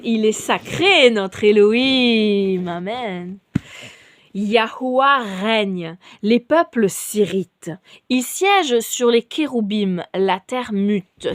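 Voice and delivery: slow, measured psalm reading; solemn scripture reading. Acted. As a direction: read it like liturgy. Reads a psalm aloud.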